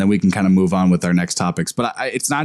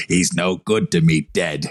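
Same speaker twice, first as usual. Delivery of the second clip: a lightly gravelly Cockney Boba Fett line; 4 semitones lower than usual. Retold or imitated. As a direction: drop in a hint of Cockney, low and rough. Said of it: "the way he says".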